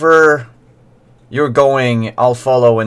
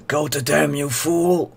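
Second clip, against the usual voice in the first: Lombard's gruff order; 6 semitones higher, 4 dB quieter than usual. Acted. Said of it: "Lombard says".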